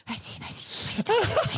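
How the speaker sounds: mock whispered nudging, jumping high at the end; hushed mock urging that turns squeaky; imitating awkward prodding. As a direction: imitate awkward behind-the-scenes prompting. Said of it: "voir la gêne entre vous deux faire".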